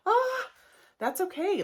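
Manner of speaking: high, chirpy reassurance; mock reassuring guest. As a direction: brush it off brightly.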